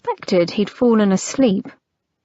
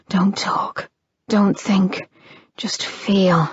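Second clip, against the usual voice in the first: whispering; slower than usual. Acted. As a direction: whisper it close to her ear. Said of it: "he murmured against her mouth".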